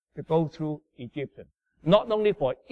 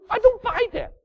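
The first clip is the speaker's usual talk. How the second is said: much higher put-on scoffing voice; mocking rebel; 4 dB louder than usual. Preacher mimicking someone rejecting God's word, scoffing. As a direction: sneer it like a stubborn rebel.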